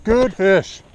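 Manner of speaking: high, delighted cheer over the catch; thrilled praise for a hooked fish; excited. Real, not acted.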